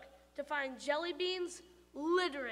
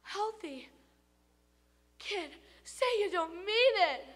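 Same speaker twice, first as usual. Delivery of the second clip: high, aghast pleading; the tooth begging; 7 semitones higher, 5 dB louder than usual. Acted.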